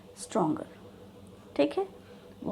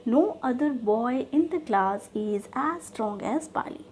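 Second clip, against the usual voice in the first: slow dictation; much slower than usual. Shown as a demonstration. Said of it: "यहाँ no other से ही शुरू करनी है".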